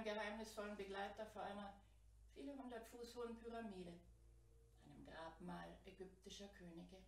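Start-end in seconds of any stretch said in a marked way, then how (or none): none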